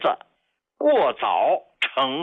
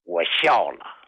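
ordinary talk, nothing marked

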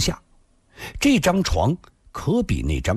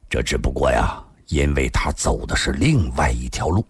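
low and sombre; deep, measured seriousness; grave, low voice; 9 semitones lower than usual. A narrator's reading. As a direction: drop the pitch and explain gravely. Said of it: "沉声地说道".

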